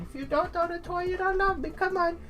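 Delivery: guilt-trip your owner as the dog; voiced dog. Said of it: "infer what she might be thinking".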